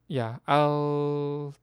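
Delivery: usual speaking voice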